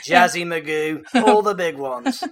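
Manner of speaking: put-on light Australian accent, "crimos" said the Aussie way; hammed-up Aussie tour guide translating. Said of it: "Perhaps you can translate afterwards".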